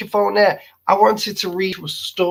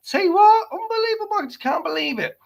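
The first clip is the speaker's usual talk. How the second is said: high squeal; 14 semitones higher, faster than usual. Real, not acted. Laughing exasperation.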